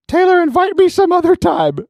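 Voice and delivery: put-on high, urgent child voice; little kid. Little kid dragged off Xbox calls to friend, high voice. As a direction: imitate a small boy calling out in a hurry. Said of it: "this little kid go".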